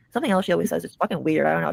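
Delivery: ordinary talk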